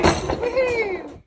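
high, loud woohoo of delight; excited cheer. Real, not acted. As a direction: cheer for the good news. Friend says stew is good.

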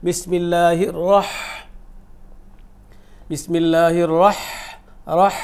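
"রহ" ending in a long mid-throat h; heavy r in "রহ", then a strong breathed h; mid-throat h recitation. Shown as a demonstration. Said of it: "তাহলে এইখানে হবে". ("রহ"-এ মোটা র, শেষে মাঝকণ্ঠের হা)

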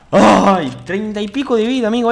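high, loud exultant cry; excited yelling. Real, not acted. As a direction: crow loudly over your great shot. Excited over the hit. (euforia a gritos)

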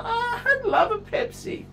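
high, teary mock whimper; fake-sob the craving; half-laughing fake cry for a Pepsi. Whimpering self-pity over a Pepsi.

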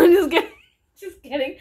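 ordinary delivery

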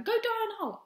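high-pitched imitated shout; mock shouting back. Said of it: "he shouted back".

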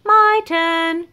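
loud high call; monkey crying out for his turn; eager cry. A narrator's reading. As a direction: shout it out grabbing your turn. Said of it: "cried Monkey".

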